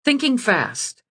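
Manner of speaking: plain reading voice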